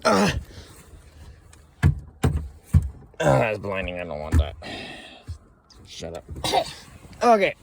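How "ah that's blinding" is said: normal talking voice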